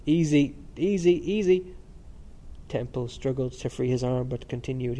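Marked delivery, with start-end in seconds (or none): 0.1-1.6 higher, repeated soothing with a smile; uneasy smile. A narrator's reading. Student checking his friend's tongue, smiling uneasily. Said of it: "smiling uneasily, and repeated".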